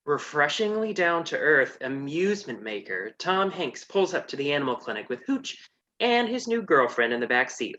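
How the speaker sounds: normal talking voice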